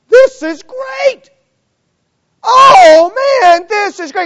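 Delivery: high, loud whoop of joy; overjoyed. Retold or imitated. Lepers cheering to each other, loud and high with joy. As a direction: shout it like you can't believe your luck.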